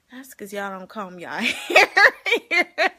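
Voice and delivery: slow, laughing retort; laughing comeback. Retold or imitated. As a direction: drag it out while laughing. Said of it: "I'm like".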